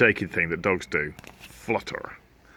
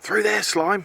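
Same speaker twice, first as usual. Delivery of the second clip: calling out; 9 semitones higher than usual. Acted. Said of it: "he called out".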